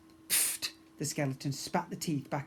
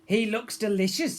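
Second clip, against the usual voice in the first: hungry Gladys; 6 semitones higher, 7 dB louder than usual. Acted. Gladys Potts voiced.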